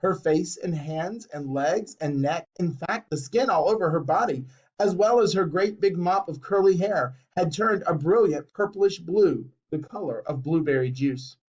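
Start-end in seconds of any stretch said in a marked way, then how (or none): none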